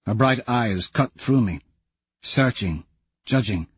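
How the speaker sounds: ordinary delivery, nothing marked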